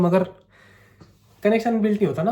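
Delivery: ordinary delivery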